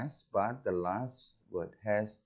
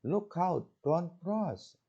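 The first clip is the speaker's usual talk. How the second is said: "Look out, don't cross" called out much higher, every word stressed; rising warning; 10 semitones higher, much slower than usual. Shown as a demonstration. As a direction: shout a warning of danger, pitch up high. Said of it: "kalau yang warning".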